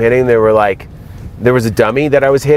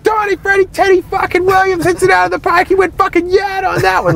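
high, fast Boston sports brag; Boston bragging. Retold or imitated. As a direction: brag like a Boston baseball fan. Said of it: "a Boston guy would start bragging".

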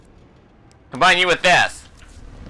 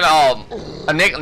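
ordinary talk, nothing marked

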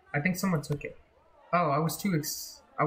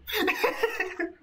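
high burst of laughter; relieved laugh. Real, not acted. Player after escape, relieved laugh.

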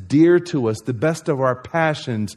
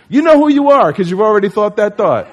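hyped-up call-out; 6 semitones higher, 8 dB louder than usual. Real, not acted. Pumping up the athletes.

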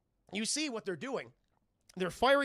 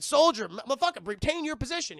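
commanding shout; 6 semitones higher, 9 dB louder than usual. Retold or imitated. Commander over radio.

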